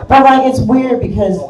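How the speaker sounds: ordinary talk, nothing marked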